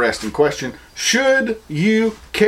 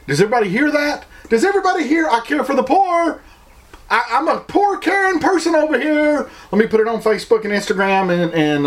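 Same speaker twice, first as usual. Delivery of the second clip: much higher, showy mock voice of a virtue signaler; 10 semitones higher than usual. Mocking social media do-gooders to his viewers, high parody voice. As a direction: parody a show-off begging for attention. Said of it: "the instant response of everybody is".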